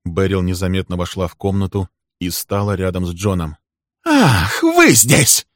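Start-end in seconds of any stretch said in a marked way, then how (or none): 4.0-5.5 high, loud exclamation; furious, soaring cry; enraged discovery. A narrator's reading. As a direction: pounce on the unexpected witness. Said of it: "Полной безумной ярости взглядом".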